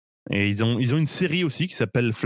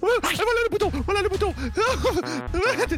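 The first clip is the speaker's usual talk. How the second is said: being tickled. Acted. Touché à la fin du touche-touche.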